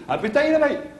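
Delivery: high chirpy boast; excited boast. Pretend. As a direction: brag like a giddy winner. Imagined winner boasting.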